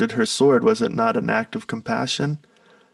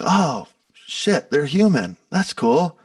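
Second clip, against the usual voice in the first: higher, playfully amazed discovery; mock surprise; 4 semitones higher, slower than usual. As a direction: pretend you just realized it. Imitating fans discovering celebrities' humanity.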